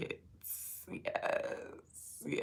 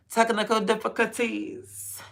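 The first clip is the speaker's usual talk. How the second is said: mock announcer; 6 semitones higher, 10 dB louder than usual. Announcing mock technical trouble.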